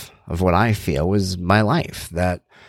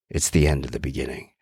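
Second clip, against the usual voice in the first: lower, softer, menacing wrestler growl; scary Jake the Snake voice; 8 semitones lower, 5 dB quieter than usual. Retold or imitated. Podcaster quoting the wrestler's punchline, low scary wrestler voice. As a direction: drop low and quiet like a wrestler's threat. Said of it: "scary Jake the Snake voice".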